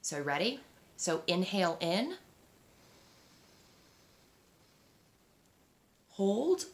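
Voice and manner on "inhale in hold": calm breathing coach; slow, calm counted breathing cues; guided breathing cues. Shown as a demonstration. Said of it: "show you what it looks like".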